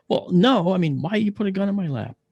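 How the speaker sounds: high, exasperated retort; indignant protest. Retold or imitated. Patient arguing with the kid over the gun, indignant.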